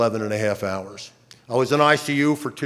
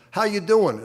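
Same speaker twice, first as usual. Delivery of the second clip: friendly greeting; 9 semitones higher than usual. Retold or imitated. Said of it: "he says, uh".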